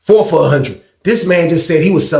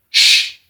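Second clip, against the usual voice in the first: drawn hushing sound; shushing. Retold or imitated. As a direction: imitate the cellmate's shush. Cellmate shushes them, retold.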